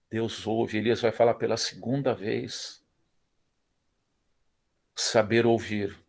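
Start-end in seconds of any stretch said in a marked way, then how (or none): none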